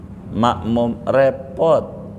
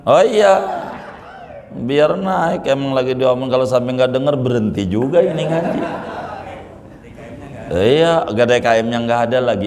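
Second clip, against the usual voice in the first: laughing aside; 6 semitones higher, faster than usual. Real, not acted.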